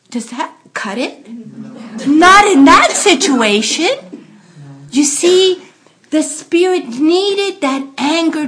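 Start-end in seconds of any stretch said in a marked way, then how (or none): 2.1-8.5 emphatic. Acted. Emphatic preaching point.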